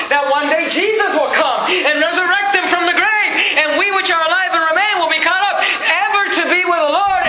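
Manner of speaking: high, ringing preacher's climax; impassioned pulpit crescendo, pitched high; fervent preaching. Real, not acted. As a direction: proclaim the hope with rising fervor. Preaching builds to the hope.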